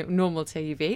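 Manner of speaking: ordinary talk, nothing marked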